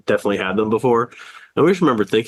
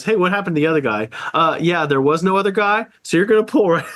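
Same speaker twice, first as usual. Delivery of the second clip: higher, brisk mock voice of the new guy; playful higher character voice, cheerfully denying anything happened; other guy's voice; 6 semitones higher than usual. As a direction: imitate a breezy replacement worker covering it up. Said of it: "He goes".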